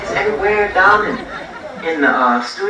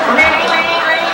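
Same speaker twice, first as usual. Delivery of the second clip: high, loud mock alarm bell; imitating an alarm; 9 semitones higher, 3 dB louder, slower than usual. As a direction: ring it out like a casino alarm going off. Casino alarm goes off.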